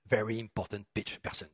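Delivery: low, quiet mock English accent. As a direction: toss it off softly in your best attempt at an English accent. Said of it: "Toujours avec mon accent anglais".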